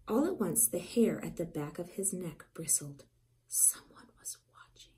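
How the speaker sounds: tense hushed whisper; whispered suspense. A narrator's reading. Narrator whispering as the dog senses eyes. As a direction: let the dread creep in softly. Hair bristles, someone watching.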